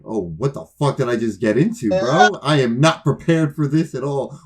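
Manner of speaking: panicked inner thought. Retold or imitated. Panicked inner voice after call.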